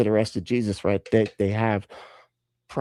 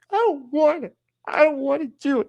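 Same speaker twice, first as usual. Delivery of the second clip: very high-pitched petulant refusal; hammed-up childish sulk; whiny child. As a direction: whine it like a stubborn kid. Peter retold as sulky child.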